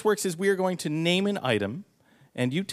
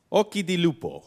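put-on Italian accent; 3 semitones higher than usual. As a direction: savor it like an Italian chef. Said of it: "Your Italian accent is phenomenal".

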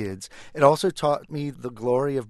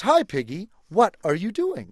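slightly raised, friendly elephant voice; a lightly voiced, good-natured elephant; Elephant's greeting; 6 semitones higher, 4 dB louder than usual. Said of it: "me as the elephant".

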